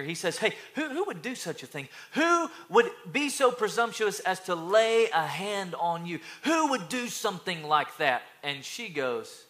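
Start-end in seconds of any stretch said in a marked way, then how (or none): 0.4-8.2 acted outrage, raised and emphatic; indignant, punching out the questions; raging king. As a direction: demand answers as an outraged king. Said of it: "he kinda starts raging here".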